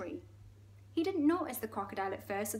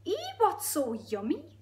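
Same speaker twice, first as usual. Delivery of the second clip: high, slow, curious Geordie; Geordie hippo; 8 semitones higher, 4 dB louder than usual. Acted. Hippo asking about the meal, curious Geordie. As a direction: ask in a swooping Geordie lilt. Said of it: "It's really Geordie".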